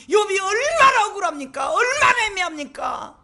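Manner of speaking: high, tearful preaching cry; impassioned outcry. Acted. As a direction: plead high for Job's injustice. Preacher crying out Job's suffering. (높은 목소리로 울부짖듯 설교)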